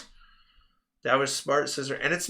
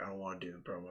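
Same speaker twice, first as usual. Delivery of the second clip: reluctant mumble; 6 semitones lower, 17 dB quieter, much faster than usual. Retold or imitated. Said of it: "And he's like".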